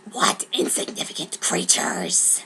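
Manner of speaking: low whispered sneer; contemptuous whisper. Acted. The broom sneering at people, whispered. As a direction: scorn the humans under your breath. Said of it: "Braum said".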